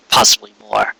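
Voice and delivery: plain reading voice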